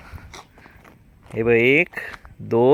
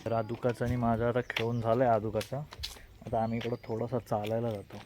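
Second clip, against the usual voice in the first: quiet vlog aside; 10 dB quieter than usual. Real, not acted. Parent tells the camera what they are doing next, soft and quiet. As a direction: talk softly, just for the viewers.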